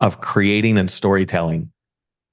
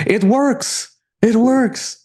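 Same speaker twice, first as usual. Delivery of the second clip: squealing it out way up high, thrilled; excited client; 13 semitones higher, much slower than usual. Retold or imitated. Client telling coach the content finally paid off, giddy high squeal. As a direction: imitate a client's giddy high-pitched joy.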